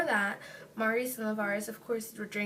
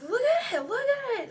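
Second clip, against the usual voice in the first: high, louder panicked plea; distraught screaming; 12 semitones higher, 4 dB louder than usual. Retold or imitated. Telling drunk friend to look at her leg, retold distraught cry. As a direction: re-enact frantic pleading, pitch leaping up. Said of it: "I was screaming and crying".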